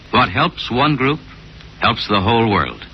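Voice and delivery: ordinary talk, nothing marked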